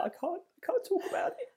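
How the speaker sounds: high, choked-up mock sob voice; high wobbly impression of a teary partner; imitating teary voice. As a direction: squeak it out like you're about to cry. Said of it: "you were like".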